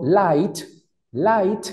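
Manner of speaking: "light" with a crisp T, lips in a slight smile; careful English light. Shown as a demonstration. Teacher shows students how to say "light", crisp final T. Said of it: "uno como que intenta sonreír".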